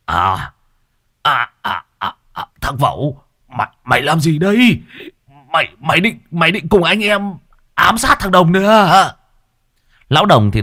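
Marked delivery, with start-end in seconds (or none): 0.1-9.1 put-on high, stammering terrified old man; startled brother yelling at the attacker; terrified shriek. A narrator's reading. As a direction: stutter out the accusation in horror. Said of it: "rú lên kinh hãi".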